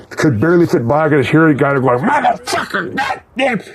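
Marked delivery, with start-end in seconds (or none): 2.0-3.6 high, far New Jersey cussing; New Jersey accent. Retold or imitated. Cemetery worker cursing at the band's van, New Jersey accent. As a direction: imitate a grumpy New Jersey worker swearing. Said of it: "This fucking New York, N-, New Jersey accent".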